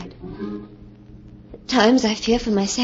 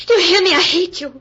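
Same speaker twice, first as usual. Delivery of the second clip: raised, higher and louder, furious; angry shout; 6 semitones higher, 4 dB louder than usual. Acted. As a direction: shout it at him in rage. Heated quarrel, demanding she listen.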